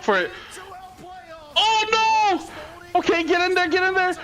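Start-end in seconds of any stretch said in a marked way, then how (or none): 1.6-4.1 ecstatic cheering. Real, not acted. Streamer laughing at the Chiefs losing, ecstatic shouting. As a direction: lose it over the comeback.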